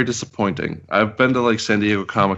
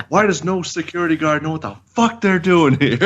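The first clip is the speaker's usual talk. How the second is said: high, exasperated outburst at the guards; exasperated rant; 8 semitones higher than usual. Retold or imitated. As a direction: throw up your hands in disbelief. Angry retelling of security guards.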